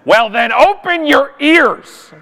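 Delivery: snippy retort. Retold or imitated. Flight attendant snapping back at the passenger. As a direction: spit back each word with contempt. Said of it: "she looks me right in the eye and says".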